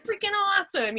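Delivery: high, loud, breathless glee; a dog who found the best toy ever; overjoyed dog. Retold or imitated. Dog delighted the chicken toy screams, loud high glee. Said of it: "He's like".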